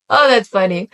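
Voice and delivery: ordinary delivery, nothing marked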